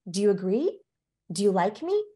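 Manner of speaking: short questions with rising ends; approval-seeking uptalk. Shown as a demonstration. Warm person desperate to be liked, pleading uptalk. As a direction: lift each ending, asking to be liked. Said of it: "use more up talk because".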